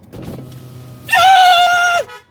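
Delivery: loud, high cackling laugh; burst of laughter. Real, not acted. Streamer reacts to neighbor's look.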